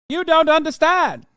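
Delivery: put-on high, loud transatlantic accent; hammy old-Hollywood transatlantic accent. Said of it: "transatlantic accent".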